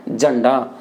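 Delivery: झंडा with plain j and low pitch; Haryanvi झंडा. Shown as a demonstration. Teacher showing the Haryana form, झंडा low. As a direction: say झंडा without the breathy jh, low. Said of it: "वहाँ उच्चारण क्या हो रहा है?".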